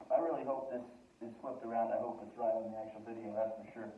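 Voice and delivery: ordinary talk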